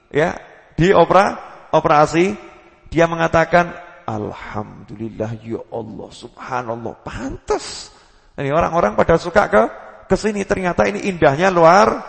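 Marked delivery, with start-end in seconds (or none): 4.1-7.9 soft, low, slow murmur of awe; awed murmur. Retold or imitated. Healed man marvelling at the view, hushed and awed. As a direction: breathe the praise out in wonder.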